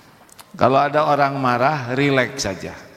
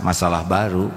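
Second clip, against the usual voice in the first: normal talking voice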